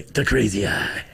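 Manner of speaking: low, slow mock-menacing crazy-eye voice. Streamer threatening chat trolls with a mock menacing crazy-eye voice. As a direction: lean in with a lightly hammed madman stare. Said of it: "what I like to call".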